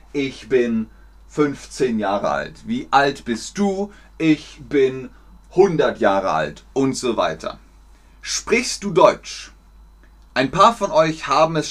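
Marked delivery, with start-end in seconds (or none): none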